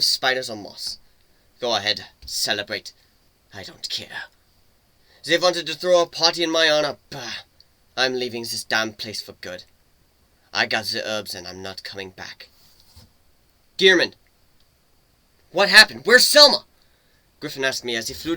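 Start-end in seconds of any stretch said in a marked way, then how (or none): 1.6-4.3 bitter whispered dismissal. Acted. Turning away from celebrations. 13.8-16.6 loud, high urgent questions; alarmed calling. Acted.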